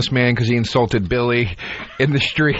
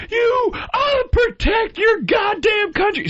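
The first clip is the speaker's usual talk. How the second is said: much higher, very slow hammed-up shrill yell; mock yell; much slower than usual. Mocking the Navy woman's rant, hammed-up high yell. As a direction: ham up the angry veteran. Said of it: "she starts going".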